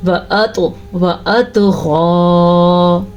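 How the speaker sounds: وَأَطْغَى with heavy ط bounced, slow; qalqala on ta. Shown as a demonstration. Teacher showing the qalqala and heavy ta, slow chant. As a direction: bounce the ط of وَأَطْغَى, keep it full. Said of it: "کیسے؟".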